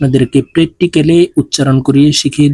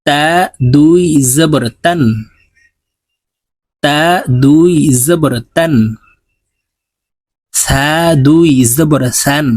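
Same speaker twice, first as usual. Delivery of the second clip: slow sing-song letter drill, each line rising; tajweed recitation drill; much slower than usual. Shown as a demonstration. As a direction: chant each letter for students to repeat. (ধীর সুরেলা তাজবিদ উচ্চারণ অনুশীলন)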